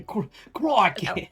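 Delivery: mock Australian accent teasing her plan to fit in. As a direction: stretch out crikey like a cartoon Aussie. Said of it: "You're just gonna".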